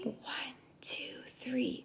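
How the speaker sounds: whispered count; whispered counting to three; calm whispered counting. Shown as a demonstration. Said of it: "taken a deep breath and counted".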